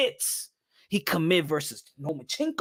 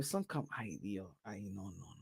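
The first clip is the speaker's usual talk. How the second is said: dismayed fading moan; 10 semitones lower, 19 dB quieter than usual. Real, not acted. Dismayed at what he sees.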